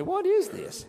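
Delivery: puzzled question. Retold or imitated. Israelites puzzled by the manna.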